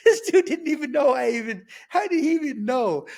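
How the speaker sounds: laughing, high and loud astonishment; amused disbelief. Real, not acted. Inmate recalling the Bible handed to him, amused disbelief. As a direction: burst out incredulously through a laugh.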